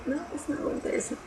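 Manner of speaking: ordinary talk, nothing marked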